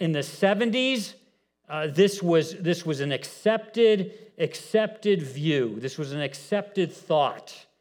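ordinary talk, nothing marked

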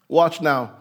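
ordinary talk

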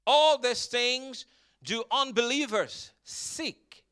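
drawn out slowly on all these things; slow emphasis. Shown as a demonstration. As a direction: lay it out slowly for them. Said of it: "repeats verse slowly".

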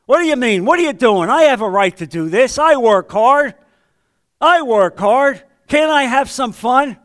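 hammed-up angry husband; lashing out. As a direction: whine and shout back at the wife. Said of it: "the old you would lash out".

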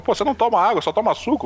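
high-pitched retold ribbing; joking tease. Vascão ribbing him in Monte Carlo, teasing. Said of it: "começou a brincar comigo".

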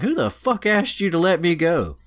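high-pitched outraged girl's voice, acted; indignant girl. Freed raccoon girl confronting the lance hero, indignant.

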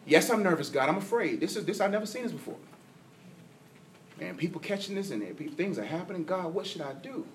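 soft, hushed plea to God, half to himself; hushed nervous prayer. Retold or imitated. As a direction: pray it quietly, like a scared confession.

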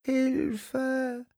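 very high, thin, trembling puppet voice; a tiny scared dwarf calling out for help; trembling little voice. A narrator's reading. Frightened puppet dwarf calls Simon in the dark hallway, high and trembling. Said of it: "zittriges Stimmchen".

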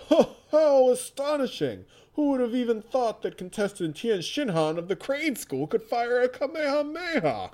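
high, loud amazed announcer; excited announcer. Acted. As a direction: sell the shock to the crowd. Announcer reacts to the blast.

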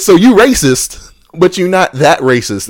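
storyteller mocking the patient with sarcastic emphasis; sarcastic mockery. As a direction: spell out the absurdity in mock disbelief.

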